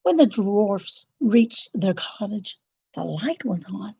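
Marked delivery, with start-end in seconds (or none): none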